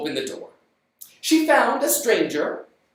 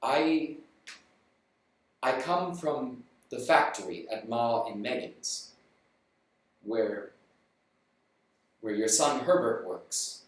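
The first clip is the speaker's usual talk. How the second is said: low, soft, halting messenger; a grave man dreading his news; nervous solemn stranger; 8 semitones lower, 6 dB quieter than usual. Acted. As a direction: stranger bracing to tell the parents. Stranger hesitantly explains himself.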